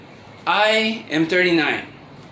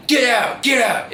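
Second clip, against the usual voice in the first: retold shout; 8 semitones higher than usual. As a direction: throw him out in a mock shout. Retold man shouting her out.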